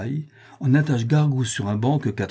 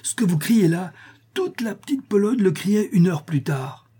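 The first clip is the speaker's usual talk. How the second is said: higher-pitched, pleased cry; delighted exclamation; 6 semitones higher than usual. A narrator's reading. Storyteller thrilled with his tale's success, exclaiming in delight. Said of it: "s'écria Pique-Vinaigre, enchanté".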